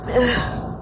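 soft tearful sigh; letting out a breath after crying; shaky sigh. Real, not acted. Pausing to recover from tears, a soft sigh.

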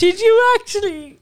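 shocked disbelief. Real, not acted. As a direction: gasp it like you can't believe it. Listener reacts to jam bagel.

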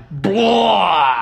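high, drawn-out bored blah. Retold or imitated. The class mocking a keen classmate with a bored blah. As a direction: groan it out like the whole class is bored. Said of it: "we were all like".

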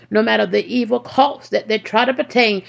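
ordinary delivery

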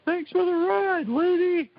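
high, slow, eerie movie-ghost delivery; spooky hitchhiker line. Retold or imitated. Quoting a horror anthology's famous line, high and eerie. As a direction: float the line up high like a ghost giving thanks. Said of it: "which has the very famous line".

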